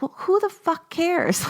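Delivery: who cares, defiantly; defiant scoff. Real, not acted. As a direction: toss it away with a defiant laugh in the voice. Defiant exclamation.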